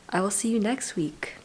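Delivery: unvoiced soft whisper of a goodbye. Real, not acted. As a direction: whisper it gently to the viewers. Whispered farewell after extraction.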